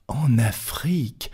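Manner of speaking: slow breathless whisper of disbelief; whispered astonished echo; stunned whisper. Acted. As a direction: breathe the words, unable to believe it. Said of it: "répéta Passepartout".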